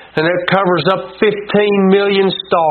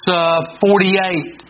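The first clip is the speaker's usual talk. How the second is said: normal talking voice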